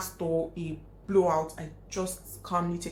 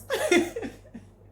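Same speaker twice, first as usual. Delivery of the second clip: burst of laughter; 7 dB louder than usual. Real, not acted. Laughs at own quote.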